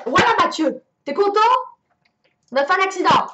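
put-on play-scold the kid who caused it; angry scolding. Mum blames son Mathieu for the car accident, scolding. Mother scolding child after crash.

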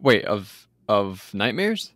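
ordinary talk

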